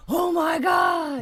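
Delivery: loud, high, drawn-out shout of shock; shocked yell. Retold or imitated. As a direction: scream it out, startled. Retold shock after binge-watching.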